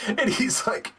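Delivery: usual speaking voice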